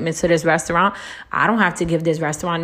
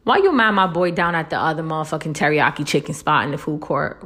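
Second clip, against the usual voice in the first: usual speaking voice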